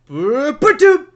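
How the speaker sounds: rising playful boops, high and loud; house landing noise. Retold or imitated. Friend imitating the house dropping, with loud high boops. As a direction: sing out the falling house as boops. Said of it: "the whole entire house drops and goes".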